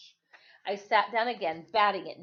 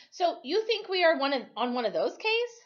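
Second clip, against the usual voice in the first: put-on higher, louder boy voice; 8 semitones higher, 5 dB louder than usual. Boy asks frightened question.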